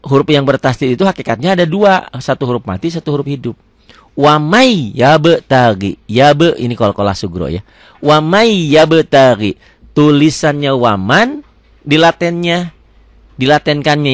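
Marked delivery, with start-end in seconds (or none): 4.2-6.0 slow "Wamai ya be tagi", lingering on the merged y; held idgham reading. Shown as a demonstration. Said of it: "Wamai ditahan". 8.0-9.6 slow "Wamai ya be tagi" with a long glide after "Wamai"; held idgham reading. Shown as a demonstration. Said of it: "Wamai ditahan".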